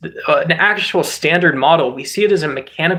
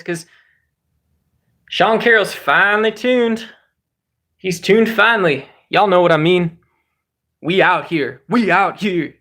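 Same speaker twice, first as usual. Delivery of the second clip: whooping bro hyping his idol; hyped-up fan; 4 semitones higher than usual. Acted. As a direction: cheer like a rowdy fan, stretching the big words. Acts a hyped-up physics fan.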